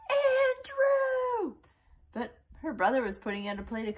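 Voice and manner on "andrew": high loud shout for dad; yelling. Acted. Girl trapped in a box calling her dad, long high shout. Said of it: "She yelled".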